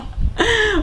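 a bright high burst of giggling; high giggle. Real, not acted. Streamer giggling about friends sneezing behind her.

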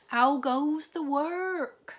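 high, loud, slow bellow from bed; lazy farmer calling. A narrator's reading. Lazy farmer calling from bed to the duck, high loud bellow. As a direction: boom it out like a lazy boss.

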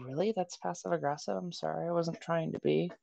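very soft, mildly surprised apology; clueless apology. Acted. Voicing people unaware they seem passive aggressive, meek apology. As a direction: quietly act innocent of any offence. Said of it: "have no clue that they're coming off".

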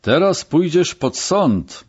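slow, high-pitched rasp; hoarse threat. A narrator's reading. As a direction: threaten hoarsely, stretching the words. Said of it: "chrypiał komendant". (chrapliwa, powolna groźba)

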